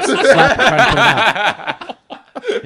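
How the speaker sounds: high, loud, laughing rush; laughing panic. Retold or imitated. Acting out panicked slapping, laughing.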